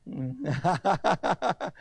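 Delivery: rapid ha-ha laugh; hearty chuckle. Real, not acted. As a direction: laugh at your own joke in a quick run. Laughs at own joke.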